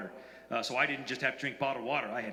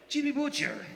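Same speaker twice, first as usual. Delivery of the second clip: alarmed; 9 semitones higher than usual. Retold or imitated. Carlos alarmed by deadly spider.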